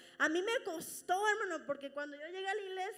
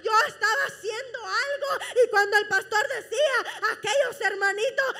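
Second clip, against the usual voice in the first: louder fervent sermon voice, laughing and choked up; full-force preaching; 4 semitones higher, 12 dB louder than usual. Acted. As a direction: preach it hard, voice breaking with emotion. (predicando con toda la fuerza, entre risas y llanto)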